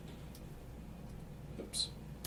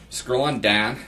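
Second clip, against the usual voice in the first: "Scroll on down" in a Pittsburgh accent, a little louder; 4 semitones higher, 8 dB louder, slower than usual. Shown as a demonstration. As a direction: say "Scroll on down" like a Pittsburgh local, with the "on" rising. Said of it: "That's the Pittsburgh accent".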